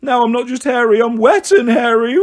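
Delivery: high, loud mock wail; hammed-up crying child; tearful little boy. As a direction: bawl it out miserably. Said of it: "tears streamed down his furry face".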